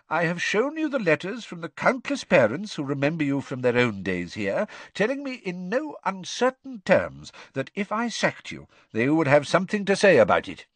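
put-on high, emphatic old headmaster; Dumbledore, firm.

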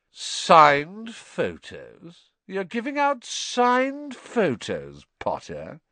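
slow, high, incredulous mockery; loud and scathing. A narrator's reading. Malfoy mocking Harry across the courtyard, scathing. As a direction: draw it out with loud scorn, dropping on the name. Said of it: "Loud and scathing, Draco Malfoy's voice echoed".

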